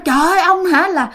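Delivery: high, indignant scolding that keeps climbing; father scolding the stranger out of his house, high and indignant; retold scolding. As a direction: chase the old man out, pitch rising. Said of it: "Xong cái ba chửi quá trời luôn".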